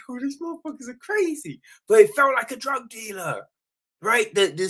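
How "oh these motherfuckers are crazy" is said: awed viewer aside. Retold or imitated. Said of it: "you were just like".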